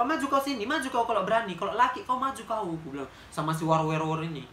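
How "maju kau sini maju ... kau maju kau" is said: higher-pitched fighting challenge; daring the beggar to fight, higher and heated; angry dare. Retold or imitated. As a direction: dare him to step up and fight.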